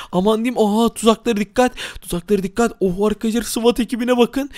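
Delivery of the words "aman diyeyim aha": ordinary talk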